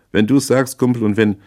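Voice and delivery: high, loud mockery; jeering. A narrator's reading. Brody answers the proposed cover story, jeering. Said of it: "höhnte Brody".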